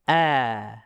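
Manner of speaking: "a" with mouth fully open; open fathah. Shown as a demonstration. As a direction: release a long, free "a". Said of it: "lepas a".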